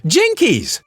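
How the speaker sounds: put-on a girl shocked at the sight; Velma crying out. A narrator's reading. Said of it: "Velma cried".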